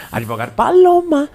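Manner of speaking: put-on character voice, high and drawn out; Advogado Paloma. Imitating a comedian trying a different voice, high slow character voice. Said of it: "tenta fazer uma voz diferente".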